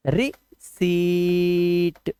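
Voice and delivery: रिसीट drawn out very slowly as ri-seet; slow model word. Shown as a demonstration. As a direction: stretch रिसीट long and clear for learners. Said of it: "तो आप इसे कैसे लिखेंगे". (बहुत धीमे, खींचकर रिसीट)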